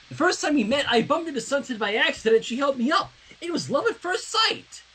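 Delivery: high, dreamy, gushing schoolgirl voice; lovestruck manga girl. Acted. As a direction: gush like a smitten anime heroine. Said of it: "why does it sound like it's a fucking manga".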